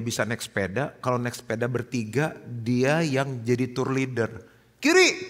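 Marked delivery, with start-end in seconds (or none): 4.8-5.3 aba-aba lantang. Retold or imitated. Anak memberi aba-aba pemimpin tur.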